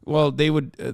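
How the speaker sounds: normal talking voice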